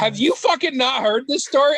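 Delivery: loud, high disbelieving burst; blurting out in disbelief; shocked outburst. Real, not acted.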